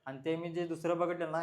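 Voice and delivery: usual speaking voice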